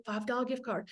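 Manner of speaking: soft repeat of "five dollar gift card" to yourself; quiet aside. Shown as a demonstration.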